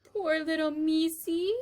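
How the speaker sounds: Mig's pitying murmur; tender pity. Acted. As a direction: coo over the drowning mouse. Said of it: "she said".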